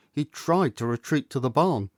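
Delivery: plain reading voice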